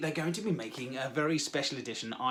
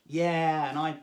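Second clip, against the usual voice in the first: normal talking voice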